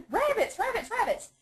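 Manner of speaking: squeaky high-pitched rabbits; silly high squeak. Pretend.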